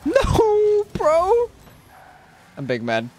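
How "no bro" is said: high-pitched protest. Real, not acted. Gamer protesting a bad goal, high yelp. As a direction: shoot up high, disbelieving.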